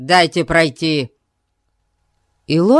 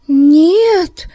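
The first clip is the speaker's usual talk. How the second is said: high, louder woman's voice; frightened woman; 14 semitones higher, 6 dB louder than usual. A narrator's reading. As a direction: answer in a scared high voice. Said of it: "отвечала Ребекка".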